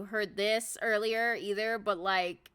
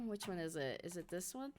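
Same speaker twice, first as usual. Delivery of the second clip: ordinary talk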